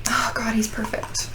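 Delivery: breathless hushed whisper; awed whisper. Real, not acted. Fan gazing at her new figure, awed whisper.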